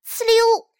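high, loud imitated slip; sudden "whoosh" of a slide; voiced cartoon slip; slipping sound. A narrator's reading.

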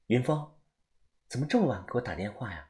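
faint, soft phone voice; distant voice on the phone; muffled phone call; over a bad phone line. A narrator's reading. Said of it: "声音听起来不是很清楚".

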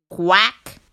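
flat little duck quack; a lightly voiced duck quack. A narrator's reading. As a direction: answer like a tired duck. Duck answers farmer.

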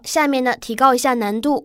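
normal talking voice

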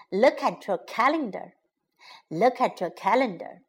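"Look at your calendar" run together briskly; quick, fluent repeat of "Look at your calendar"; natural connected speed. Shown as a demonstration. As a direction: say "Look at your calendar" fast, words linked. Teacher repeats phrase at natural speed.

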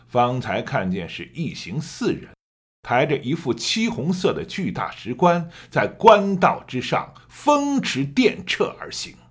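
vivid wuxia audiobook narration; story narration. Shown as a demonstration. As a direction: tell it like an epic tale. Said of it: "我可以给你做一个演示".

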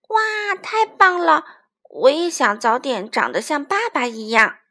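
put-on loud, excited little-child voice; childlike awe and delight; admiring baby dinosaur.

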